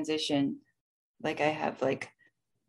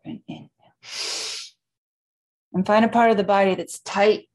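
guided breathwork. Acted. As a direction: lead the breathing gently. Guides a box breathing session.

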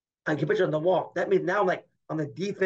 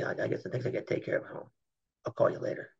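low, hurried whisper into the phone; hushed, shaken; 5 semitones lower, 8 dB quieter, faster than usual. Retold or imitated. Breaking news of a missing brother on the phone, hushed and hurried. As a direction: whisper fast, trying to hold it together.

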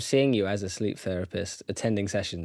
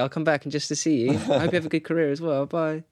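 higher, bubbly and over-the-top grateful; gushing; 4 semitones higher than usual. Retold or imitated. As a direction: gush like they made your day. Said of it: "I'd be like".

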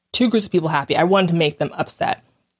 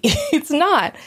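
laughing repeat; 9 semitones higher than usual. Real, not acted. Vlogger laughing as she repeats about the group, amused. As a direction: laugh it off while repeating It's not.

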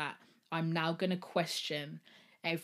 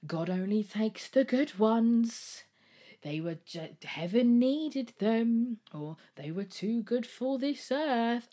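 slow, higher, louder sing-song parody of a pious cliché; mocking platitude; 6 semitones higher, 5 dB louder, slower than usual. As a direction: mimic the people who say this, with scorn. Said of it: "you're normally met with".

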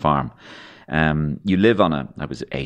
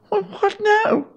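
put-on high, small child's voice asking; a young boy lost for what to do; boyish question; much slower than usual.

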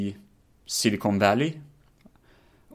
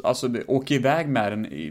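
ordinary talk

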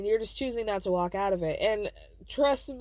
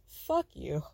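low, scornful dismissal; low contempt; 7 semitones lower, 3 dB quieter than usual. Real, not acted. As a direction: drop the pitch and dismiss them coldly. Reacting with contempt to his excuse.

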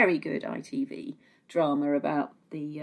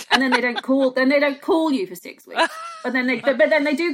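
mock outrage; 7 semitones higher, 5 dB louder than usual. Real, not acted. Mock outrage in love analogy.

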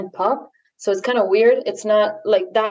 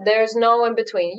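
usual speaking voice

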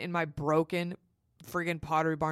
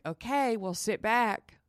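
higher, drawn-out imitation of a doula; placating doula; 6 semitones higher, slower than usual. Doula answers excited mother on the phone, placating. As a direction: stretch it out, unhurried and placating. Doula's unhurried reply, acted out.

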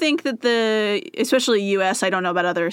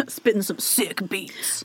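whispering; 6 dB quieter, slower than usual. Pretend. DJ bit, whispered aside.

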